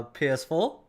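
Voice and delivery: ordinary talk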